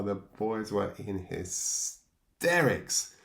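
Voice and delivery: usual speaking voice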